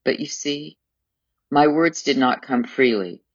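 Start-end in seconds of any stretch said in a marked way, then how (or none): 0.0-0.8 higher, tentative start; hesitant stammer. A narrator's reading. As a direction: falter lightly, taken aback. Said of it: "I stammered".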